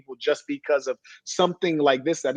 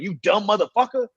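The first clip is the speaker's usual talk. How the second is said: mock yell; 7 semitones higher than usual. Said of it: "he's yelling it out loud as hell".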